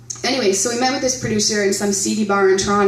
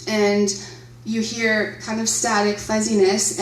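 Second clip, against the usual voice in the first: ordinary talk, nothing marked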